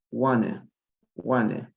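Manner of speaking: "wanna" with a very weak final vowel; reduced "wanna"; weak form. Shown as a demonstration. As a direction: let the end of "wanna" go weak, no T. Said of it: "se pronuncia de una forma muy débil".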